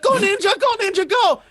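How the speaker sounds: high-pitched mock cheering; hammed-up squeaky cheerleading; imitating excited boy. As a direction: chant it high like an excited kid. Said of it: "He's like".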